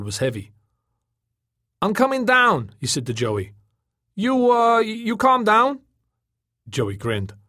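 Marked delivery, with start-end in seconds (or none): 1.8-2.7 high, loud announcement; calling down. A narrator's reading. Tom warns Joey from the floating Packard, calling down. 4.1-5.8 high, loud, hesitant question; wary call. A narrator's reading. Tom checks on angry Joey before landing, wary call.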